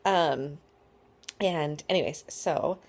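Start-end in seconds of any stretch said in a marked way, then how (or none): none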